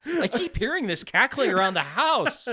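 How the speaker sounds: high, loud hammed-up complaint; exaggerated clueless kid voice; mock puzzled son. Acting out Harry hearing the cackle.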